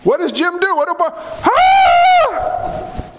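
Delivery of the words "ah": sudden high yelp, hammed-up; mock startled shout. Preacher playfully yelping at a member as if handed cancer, mock shout.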